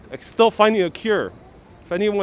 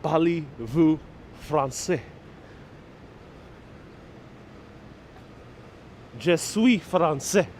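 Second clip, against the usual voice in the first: mock French; 4 dB quieter, slower than usual. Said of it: "Brian, do I speak French?".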